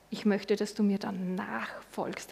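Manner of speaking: slow whispered call to follow; a slow, hushed invitation to follow. Acted. Jesus speaks tenderly.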